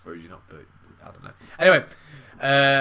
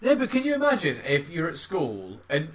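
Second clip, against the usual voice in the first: ordinary talk, nothing marked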